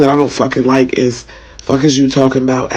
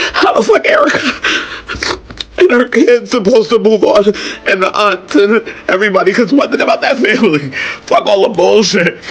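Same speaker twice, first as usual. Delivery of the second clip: much higher, tearful voice cracking with rage; angry crying; 6 semitones higher than usual. Real, not acted. Mourning a murdered little girl to viewers, weeping in fury. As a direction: plead through sobs, barely holding together. Grieving rant about child's killing.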